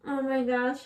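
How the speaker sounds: sleepy sigh after a yawn. Real, not acted. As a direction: breathe it out through a yawn. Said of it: "sorry if I'm yawning".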